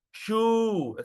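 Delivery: shoe held on a long OO; pulled vowel. Shown as a demonstration. Teacher shows pulling the vowel, drawn-out demo. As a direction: stretch shoe right out. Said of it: "you pull the vowel".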